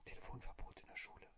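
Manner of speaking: soft secret whisper; whispering on the phone. Retold or imitated. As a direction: whisper so nobody hears. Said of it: "warum flüsterst du so?". (leises Flüstern am Telefon)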